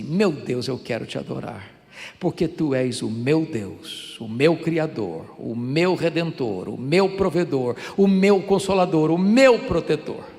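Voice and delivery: preacher leads the church in adoring God; prayer of adoration. Acted.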